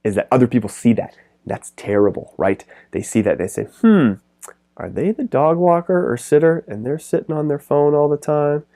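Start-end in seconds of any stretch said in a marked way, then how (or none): 3.8-8.6 higher-pitched, questioning imitation of a doubtful onlooker; sceptical onlooker. Park onlookers judging a sitter on their phone, imitated sceptically. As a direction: open on a high, doubtful hum and keep the question raised.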